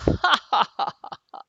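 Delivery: loud jeering ha ha ha; mocking laugh. A narrator's reading. Bully boys laughing at the goldfish, jeering. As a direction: laugh at the kid to tease him. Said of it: "Dylan and Ben laugh".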